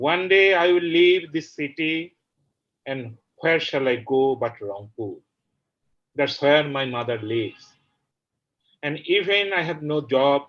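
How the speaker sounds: declaimed openings, settling into measured verse; poetry recitation. Acted. Poet reading the translated version to the audience, poetry recitation. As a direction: recite the verse line by line with deliberate pauses.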